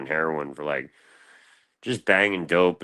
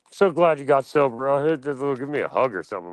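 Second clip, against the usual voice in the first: high, overly sweet cop voice, a little louder; mock friendly cop; 7 semitones higher, 3 dB louder than usual. Cop who knows him asking for a hug, high fake sweetness. Cop greeting, retold mockingly.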